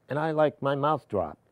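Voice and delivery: usual speaking voice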